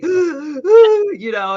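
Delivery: gasping, on the edge of tears; panicked gasp. Retold or imitated. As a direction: relive the panic in the breath. Retelling panic attacks.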